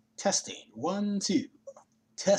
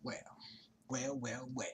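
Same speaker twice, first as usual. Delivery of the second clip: whispering; 2 semitones lower, 13 dB quieter, slower than usual. Acted. Whispered mock villain.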